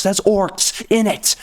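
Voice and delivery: ordinary talk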